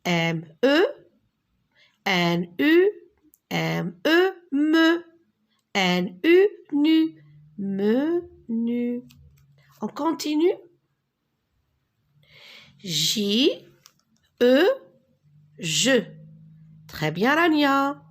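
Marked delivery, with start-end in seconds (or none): 0.0-9.0 letters blended into "me" and "nu", then "Menu"; emphatic teacher sounding out "Menu"; syllable breakdown. Shown as a demonstration. 12.8-16.0 J, E, then blended into "je"; teacher modelling "je" with pauses; spelling aloud. Shown as a demonstration. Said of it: "est-ce que tu peux répéter".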